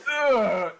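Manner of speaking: high loud groan of disgust; disgusted cry. Real, not acted. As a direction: wail in disgust. Disgusted by the image.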